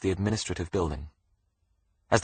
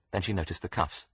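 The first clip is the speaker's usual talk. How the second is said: usual speaking voice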